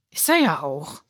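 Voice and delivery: put-on shy boy holding his ground. A narrator's reading. Benny quietly insists. (leiser, trotziger kleiner Junge)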